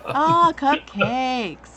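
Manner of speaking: slow, longing over cupcakes; longing drawn-out sigh. Real, not acted. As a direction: draw it out with craving. Craving cupcakes.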